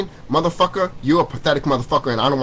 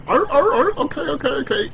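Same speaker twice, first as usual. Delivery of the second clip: mocking dumb grunts; 13 semitones higher than usual. Mocking the grandparents' generation, high grunting voice. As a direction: play the sheep grunting and agreeing. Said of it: "everyone was just like".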